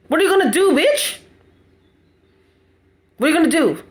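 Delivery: rising, sarcastic angry questions; bitter taunt. Real, not acted. Vlogger taunting his tormentor, bitter and angry. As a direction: jab each question at her.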